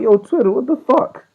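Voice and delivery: high, indignant rant; irritated complaint. Retold or imitated. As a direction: scold the app in high-pitched annoyance. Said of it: "I was pissed".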